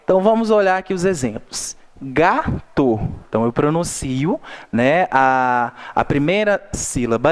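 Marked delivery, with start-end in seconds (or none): 2.0-3.0 Gato split GA-to, weight on GA; stress on first syllable. Shown as a demonstration. Teacher gives a paroxítona example, stressing GA. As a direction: lean on GA, then drop to in Gato. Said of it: "recebeu uma tonicidade maior do que o to".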